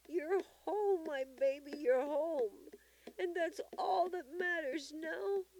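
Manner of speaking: very high, loud emotional welcome; joyful high-pitched outpouring; overjoyed grandmother. A narrator's reading. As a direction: let relief pour out high and strong. Tearful grandmother welcomes the child home.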